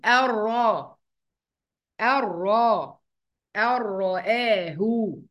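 slow "الراء" as ar-raa, doubled r; nun merged into ra. Shown as a demonstration. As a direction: stretch "الرآه" as ar-ra-aahu. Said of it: "هنقول".

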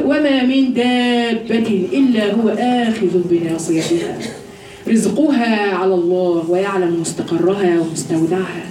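slow, chanted Quranic recitation. Acted. Preacher reciting a verse on provision to her students, chanted slowly. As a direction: draw each word out reverently.

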